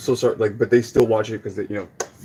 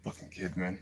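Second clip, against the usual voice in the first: much softer, muttered curse; angry mutter; 4 semitones lower, 16 dB quieter than usual. Real, not acted. Angry teacher grumbling about the kid, quiet mutter. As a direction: grumble it low to yourself.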